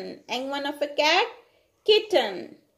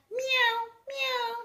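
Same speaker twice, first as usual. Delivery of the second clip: cat meow; 10 semitones higher, 7 dB quieter than usual. Shown as a demonstration. Teacher imitates cat sound.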